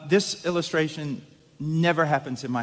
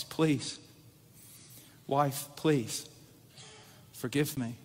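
much softer, humble plea; soft pleading; 3 semitones lower, 8 dB quieter, slower than usual. Retold or imitated. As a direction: beg quietly for forgiveness. Husband pleading on phone to wife.

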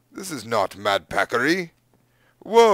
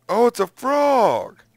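relieved surprise; 9 semitones higher, 4 dB louder, slower than usual. Real, not acted. Relief at spotting a frog.

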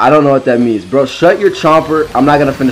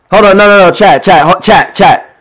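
yelling at chat; 4 semitones higher, 6 dB louder than usual. Real, not acted.

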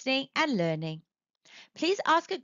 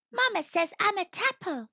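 put-on high, proud child voice; small tadpole, pleased with himself; proud little tadpole; 9 semitones higher than usual. A narrator's reading. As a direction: boast to the fish like a tiny tadpole. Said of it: "he told the fish proudly".

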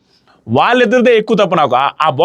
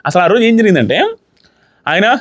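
ordinary talk

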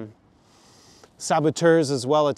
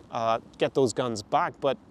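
usual speaking voice

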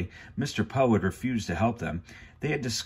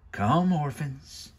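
slow, higher, drawn-out villain summons; 7 semitones higher, much slower than usual. Acted. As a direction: beckon the orphans with slow menace. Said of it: "Count Olaf said".

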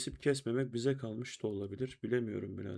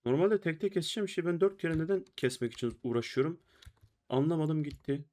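raised, higher frustrated complaint; exasperated rant; 5 semitones higher, 5 dB louder than usual. Real, not acted. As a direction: vent at the stupid boss. Frustrated at boss one-shots.

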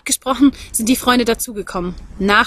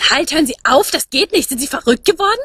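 put-on the young woman's voice jumps much higher in alarm; shrill shout; 5 semitones higher than usual. A narrator's reading. Young woman yelling at strangers taking the horse, high alarmed. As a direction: stop them, higher and urgent. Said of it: "Die Frau schrie nun".

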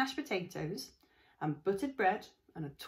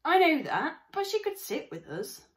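put-on higher, louder girl's retort; young girl; 9 semitones higher, 8 dB louder than usual. Sister answering her brother, higher girl voice. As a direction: snap back at her brother. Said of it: "girl answers brother".

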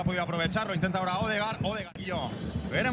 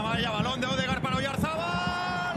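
rising goal build-up; 7 semitones higher than usual. Real, not acted. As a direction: pitch climbing, words stretched out. Build-up as the goal chance unfolds.

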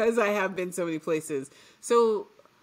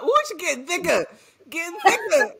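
put-on thickened Southern accent; 11 semitones higher, 8 dB louder than usual. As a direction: tease her with an exaggerated Southern accent. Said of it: "Ooh, I hear it now".

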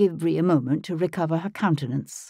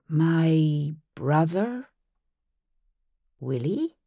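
tentative; much slower than usual. A narrator's reading. Bree stunned at news of her brother, slow and tentative. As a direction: ask it unsure of the answer. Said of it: "she asked, tentative".

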